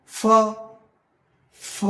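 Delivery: ফ with teeth on lip; airy F sound. Shown as a demonstration. Teacher showing learners airflow, ফ as a long F. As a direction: blow air through ফ as F. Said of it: "আমরা বাতাস বের করার চেষ্টা".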